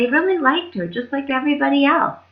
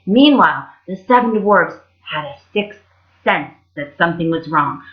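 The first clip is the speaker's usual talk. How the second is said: ordinary delivery, nothing marked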